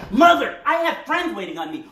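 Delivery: exasperated protest. Acted. Son with friends waiting tells his mother, exasperated. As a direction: cut her off in exasperation.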